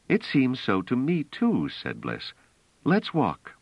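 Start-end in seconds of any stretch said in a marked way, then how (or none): 0.1-1.7 put-on light higher girl voice; Bliss voice. 2.8-3.5 put-on light higher girl voice; Bliss voice.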